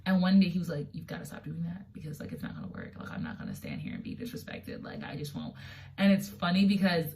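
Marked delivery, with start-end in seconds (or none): 0.9-5.5 hushed firm warning. Retold or imitated. Dax scolding about storming out.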